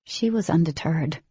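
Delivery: plain reading voice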